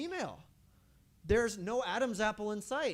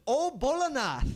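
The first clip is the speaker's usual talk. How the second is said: high, loud, slow laughing "Oh, Bolanath"; laughing greeting; 10 semitones higher, 7 dB louder than usual. Acted. Krishna greeting disguised Shiva, loud high delighted laugh. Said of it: "And then he says".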